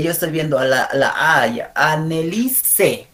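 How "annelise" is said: very slow "Annelise"; syllable-by-syllable "Annelise"; careful pronunciation. Shown as a demonstration. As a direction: draw out an-ne-li-SE. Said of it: "vamos a pronunciar estos nombres".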